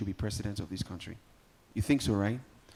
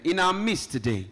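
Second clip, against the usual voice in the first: much higher and louder, stretched-out herald call; grand royal announcement; 5 semitones higher, 7 dB louder, slower than usual. Acted. MC announcing the royal father to the guests, herald style. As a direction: proclaim the king's title to the hall, slow and big. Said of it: "the official introduction".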